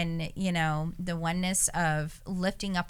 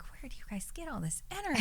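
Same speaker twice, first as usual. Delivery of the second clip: high, soft, quick marveling; amazed question; 6 semitones higher, 7 dB quieter, faster than usual. Retold or imitated. Asking the older helpers about their energy, high and amazed. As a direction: marvel at them, half laughing.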